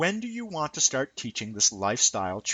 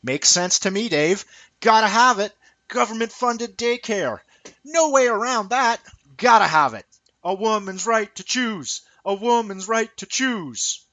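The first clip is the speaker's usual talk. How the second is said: much higher, squeaky yes-man voice, loud on the catchphrases; much higher mock daycare daddy chanting agreement; mocking agreeable husband; 11 semitones higher, 4 dB louder than usual. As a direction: parrot the slogans like a brainless nodding husband. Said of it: "you husbands and daycare daddies are just nodding".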